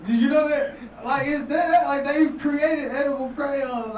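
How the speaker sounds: high, loud disbelief; incredulous. Real, not acted. Comic telling the room about edible crayons, high disbelief. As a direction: sell the absurdity.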